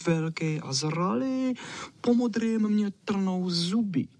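high, self-important little dog voice; Čau Čau, fussy dog. Acted. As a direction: pipe up high and prim, stretching the phrases.

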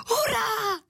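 high-pitched delighted cheer; girl's squeal. A narrator's reading. Anička hears of blueberries with cream, squealing with joy. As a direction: squeal it out like an excited little girl. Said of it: "zavískla Anička".

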